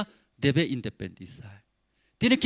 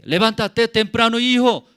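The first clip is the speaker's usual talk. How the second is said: loud, raised-pitch wake-up order; father's urging call; 6 semitones higher, 8 dB louder than usual. Acted. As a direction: call out to rouse your son. Father urging son out of bed.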